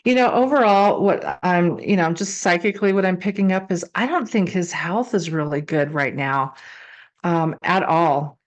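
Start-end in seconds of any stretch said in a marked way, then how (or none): none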